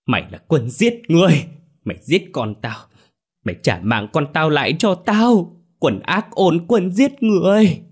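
high-pitched acted scream of a grieving mother; screaming accusation. A narrator's reading. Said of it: "Bà hét lên". (gào thét căm phẫn)

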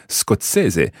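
scozzese said skots-EH-zeh, clear Italian vowels; teacher models scozzese slowly and clearly; Italian pronunciation. Shown as a demonstration. Teacher modelling scozzese for the learner. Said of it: "start with that word".

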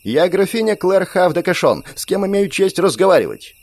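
high, proud declaration; proud countess. Acted. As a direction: announce yourself like nobility. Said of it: "громко закричала".

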